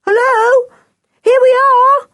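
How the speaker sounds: high, loud hello; excited shout. A narrator's reading. Children greet the others on arrival, excited shout.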